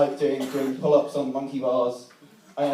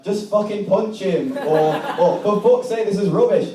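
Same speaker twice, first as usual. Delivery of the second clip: put-on Yorkshire accent; 4 semitones higher than usual. Restless playground crowd demanding action, exasperated Yorkshire accent. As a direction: jeer in broad Yorkshire, pitched up. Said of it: "I've gone Yorkshire".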